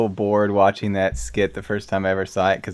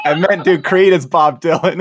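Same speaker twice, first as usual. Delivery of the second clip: loud; 8 semitones higher, 8 dB louder than usual. Retold or imitated. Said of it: "he was like".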